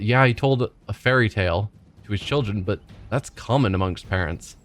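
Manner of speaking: normal talking voice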